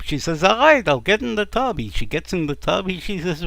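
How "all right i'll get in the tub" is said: high, loud, fed-up agreement; exasperated giving in. Acted. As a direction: give in loudly and grudgingly. Wife giving in, annoyed.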